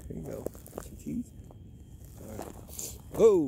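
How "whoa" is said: startled. Real, not acted. Truck approaching.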